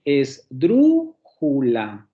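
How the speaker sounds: "Esdrújula" very slowly, syllable by syllable, DRÚ strongest; stress on DRÚ. Shown as a demonstration. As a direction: stretch and punch the second syllable of "Esdrújula", es-DRÚ-ju-la. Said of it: "una va a sonar más fuerte que las otras".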